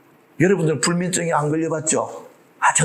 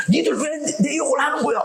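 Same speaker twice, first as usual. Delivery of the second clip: furious accusation, higher pitched; angry shout; 9 semitones higher than usual. Retold or imitated. Cain yelling at people he thinks insult him, angry shout. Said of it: "막 화를 내면서 쫓아가가지고".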